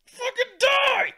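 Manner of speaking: high, loud, slow scream of disgust; exasperated shout. Acted. Reviewer screams in mock exasperation at the gangster, high and slow. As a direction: scream it like you can't take another second.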